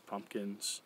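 ordinary talk

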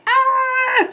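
very high drawn-out "ah" of delight; high fangirl squeal. Real, not acted. Fan spots the fake piercing, high delighted squeal.

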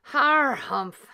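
Mister Frizzle grumbling a reluctant harrumph; gruff harrumph. A narrator's reading. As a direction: clear your throat grumpily. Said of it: "said Mister Frizzle".

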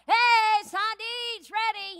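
loud, high call through the house; calling everyone to eat. Retold or imitated. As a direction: holler that dinner is ready. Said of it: "it's like me in the house".

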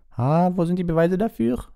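high, haughty headmistress; Madame Maxime. A narrator's reading. Madame Maxime objects to the judges, high and haughty.

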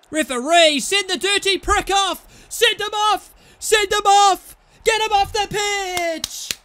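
screaming send him off, slow and raging; furious shout. Real, not acted. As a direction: yell high and loud at the referee. Furious at foul on player.

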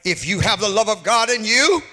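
ordinary talk

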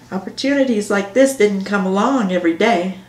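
plain reading voice

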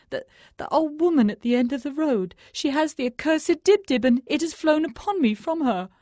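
the thief gasping out his fear of the crone; dying thief muttering. A narrator's reading. Dying thief begs the holy man, strained and urgent. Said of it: "he muttered".

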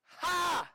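loud, high taunting "Ha!"; mocking laugh. Satan gloating over the fallen believer, a loud jeering "Ha!". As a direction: crow over him with a sharp jeering laugh.

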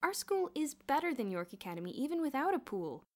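heated loyal outburst; indignant exclamation. A narrator's reading. Boots exclaims on, indignant about rival.

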